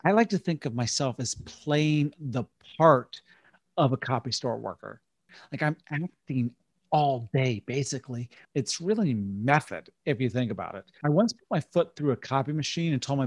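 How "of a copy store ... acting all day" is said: ordinary talk, nothing marked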